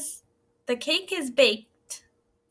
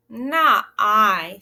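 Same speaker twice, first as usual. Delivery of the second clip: cat refusing; 2 semitones lower, 7 dB louder than usual. Acted. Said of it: "said the cat".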